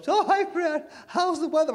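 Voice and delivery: high, sugary mock friend voice; smug Florida friend. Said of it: "She calls up".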